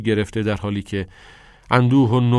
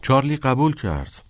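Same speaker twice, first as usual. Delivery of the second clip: usual speaking voice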